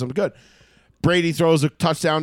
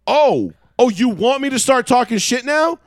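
higher and louder, squaring up; heated comeback; 8 semitones higher, 6 dB louder than usual. Retold or imitated. Fan fires back at a rival's dad, loud and higher. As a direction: bite back at him loud and high.

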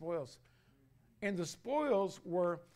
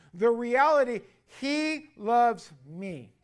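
preaching; 3 semitones higher, 4 dB louder than usual. Acted. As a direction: bear down on every word from the pulpit. Preaching at full force.

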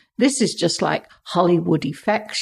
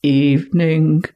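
muffled voice; 3 semitones lower, 4 dB louder than usual. Retold or imitated. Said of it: "this muffled voice saying".

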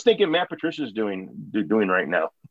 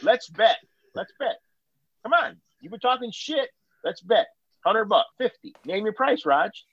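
taunting challenge; 8 semitones higher than usual. Real, not acted. Taunting friend into a bet.

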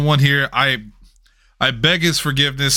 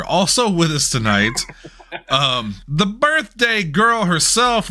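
normal talking voice

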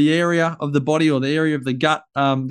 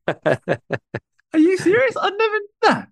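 much higher, very slow disbelief; incredulous; slower than usual. Real, not acted. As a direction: stretch it out, truly amazed. Learns co-host plays golf.

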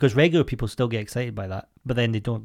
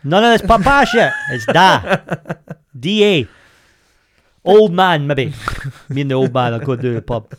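loud, punched dad corrections; mock outrage; 2 semitones higher, 7 dB louder than usual. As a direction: hammer each word home as an indignant dad. Acted dad correcting son's posh words.

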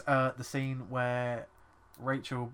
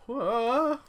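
high, loud falling yelp; Wilhelm scream yelp; 10 semitones higher, 6 dB louder than usual. Retold or imitated. Mocking the actor falling off the bed, Wilhelm yelp. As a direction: yelp like the stock movie scream. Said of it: "why does he Wilhelm".

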